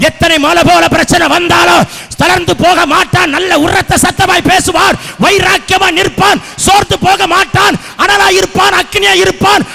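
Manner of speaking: sustained shouted exhortation; full-force preaching. Real, not acted. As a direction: belt out each promise like a revival preacher.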